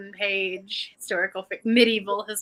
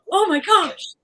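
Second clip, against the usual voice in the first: gasping oh my gosh; 7 semitones higher, 6 dB louder, slower than usual. Retold or imitated.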